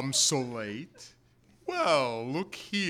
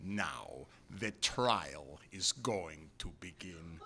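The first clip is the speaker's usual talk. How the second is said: softer, lower hushed whisper; ominous whisper; 12 semitones lower, 13 dB quieter than usual. Acted. Rebbe whispers the trial is about to begin. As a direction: breathe it out as a threat.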